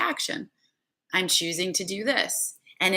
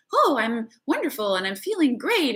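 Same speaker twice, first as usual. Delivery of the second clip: mock fairyland cheer; 6 semitones higher than usual. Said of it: "You don't have to do this in fairyland".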